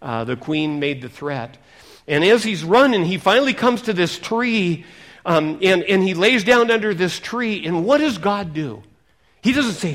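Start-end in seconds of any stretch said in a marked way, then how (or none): 2.1-9.9 loud, high fervent preaching; full-force revival preaching. Acted. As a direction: preach it out to the hall.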